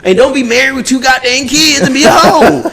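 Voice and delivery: high, laughing rant; excited rant. Real, not acted.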